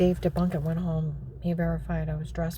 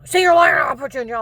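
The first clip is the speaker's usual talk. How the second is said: much higher, loud retold scream; 8 semitones higher, 14 dB louder than usual. Agent threatening jail, a loud high scream. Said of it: "He screams it again".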